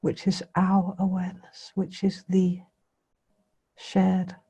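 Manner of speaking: meditation guidance in spaced phrases, long pauses; guided meditation. Real, not acted. Teacher bringing listeners' attention gently to the breathing, guided meditation. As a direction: leave silence between phrases as you guide.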